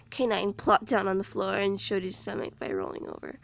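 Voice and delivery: plain reading voice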